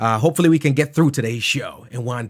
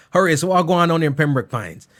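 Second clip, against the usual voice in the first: usual speaking voice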